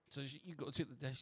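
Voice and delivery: ordinary talk, nothing marked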